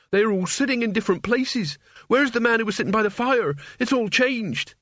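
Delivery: high, frantic and bewildered; a boy losing his grip; panicked. Acted. Mort panics.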